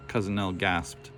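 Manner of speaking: usual speaking voice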